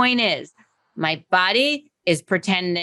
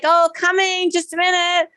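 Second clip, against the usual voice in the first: high, breezy sing-song call; pretend call to door; 10 semitones higher than usual. Trainer faking a visitor at the door, high breezy call. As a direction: pretend nobody's there, just chirp it out.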